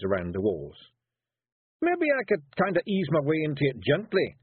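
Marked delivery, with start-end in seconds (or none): 1.8-4.4 high, Scottish Feegle warrior protesting; high Scots pixie voice wheedling his way out. A narrator's reading. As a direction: plead like a small nervous Scottish warrior. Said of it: "he protested".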